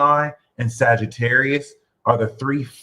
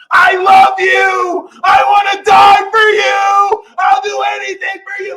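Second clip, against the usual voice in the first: mock passionate lover; 9 dB louder than usual. Mocking fire signs, a passionate lover wailing high and loud. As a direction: ham up a desperate lover crying out devotion.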